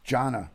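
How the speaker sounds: Jonna as a clear JAH-nuh; correct name pronunciation. Shown as a demonstration. Host repeating supporter's correction, Jonna said firmly as JAH-nuh. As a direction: land on Jonna, two syllables, JAH first. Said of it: "You're saying my name wrong".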